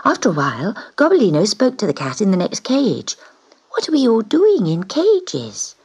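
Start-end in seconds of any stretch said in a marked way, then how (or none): 3.7-5.8 curious little cat. Acted. Kitten asks neighbour cat.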